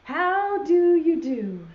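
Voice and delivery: high, slow singsong greeting; dog's greeting. Acted. Dog greeting the rooster in a poem, singsong. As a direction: draw it out in a high storybook voice. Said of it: "said the dog".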